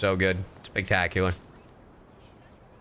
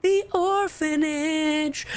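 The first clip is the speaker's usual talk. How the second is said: very high, drawn-out cry; mock wail; much slower than usual. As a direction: stretch it into a high theatrical wail. Mocking repeat of the word.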